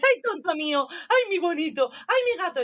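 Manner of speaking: high, loud, rising coo at a cat; sweet-talking a cat; baby talk to pet. Retold or imitated.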